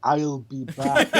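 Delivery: put-on slow, drawn-out Austrian accent; slow Austrian "I'll be back"; Schwarzenegger impression. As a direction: deliver the catchphrase slowly, like Arnold. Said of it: "huh, Arnold?".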